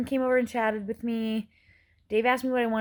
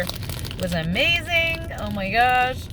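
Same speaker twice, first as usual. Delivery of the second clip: drawn-out, higher-pitched raving; gushing; 5 semitones higher, 3 dB louder, slower than usual. Real, not acted. Vlogger raving about the musical she saw, gushing. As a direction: gush slowly, savoring how good it was.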